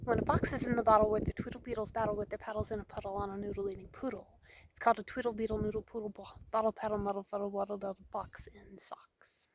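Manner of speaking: rattled off fast; tongue-twister recitation. Acted. Hiker reciting a Doctor Seuss monologue, fast tongue-twister patter. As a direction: race through the tongue twister without stumbling.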